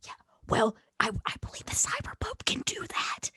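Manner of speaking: hushed in-character whisper; secretive whisper. Pretend. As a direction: whisper your suspicion to the huddle. Answering inside the secret huddle.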